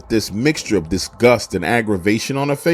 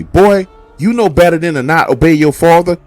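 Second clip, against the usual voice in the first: loud, higher scolding; scolding mom; 5 semitones higher, 7 dB louder than usual. Retold or imitated. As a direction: tear into the boy for disobeying. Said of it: "mom is jumping all over me".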